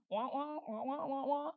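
mocking babble of a ranting person; blah-blah nagging noise; muffled wah wah talk. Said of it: "they might be screaming and hollering".

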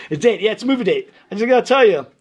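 loud, blunt Brooklyn voice; Emil Shalop. Retold or imitated. Brooklyn caller warning the new tuba player, loud and blunt. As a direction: warn like a Brooklyn contractor, rushing at the end. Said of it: "sounds like Emil".

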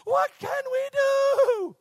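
desperate high yell, stretched long; shouting over wind. Acted. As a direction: sailor hollering through a storm. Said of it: "You have to shout".

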